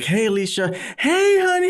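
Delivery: retold excited greeting. Retold phone greeting to wife.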